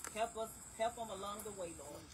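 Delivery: building from steady prayer to loud preaching cry; fervent prayer. Acted. Funeral prayer rising to fervent climax.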